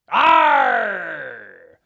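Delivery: loud, drawn-out pirate "arr"; pirate yell. Pretend. Pirate introducing the next word to children, long roared yell. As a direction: let out a big pirate roar.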